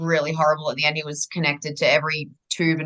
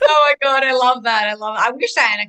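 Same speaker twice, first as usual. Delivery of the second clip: delighted; 6 semitones higher, 6 dB louder than usual. Real, not acted. Delighted reaction to friend.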